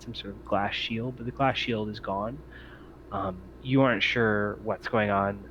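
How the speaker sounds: normal talking voice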